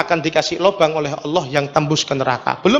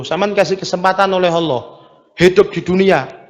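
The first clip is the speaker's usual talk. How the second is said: usual speaking voice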